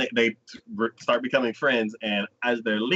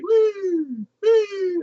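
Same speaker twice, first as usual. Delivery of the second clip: 높은 목소리로 장난스럽게 우~ 우~; 시시한 캐치볼을 놀리듯 환호; mock cheer; 4 dB louder than usual. Mocking weak casual catch.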